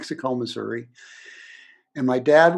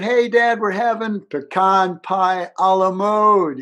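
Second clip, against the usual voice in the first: higher, louder, slow call to the next room; family calling the dessert news; calling back; 6 semitones higher, 6 dB louder than usual. Retold or imitated. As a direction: shout it back to dad, stretched out.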